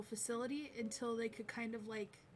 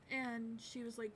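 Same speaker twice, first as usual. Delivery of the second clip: ordinary delivery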